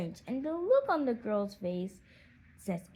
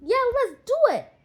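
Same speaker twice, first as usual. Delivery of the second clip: high, loud gleeful cheer; children cheering for hide and seek; excited cheer; 13 semitones higher, 9 dB louder than usual. Acted. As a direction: shout it out, thrilled to play. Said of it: "Everyone is excited about the suggestion".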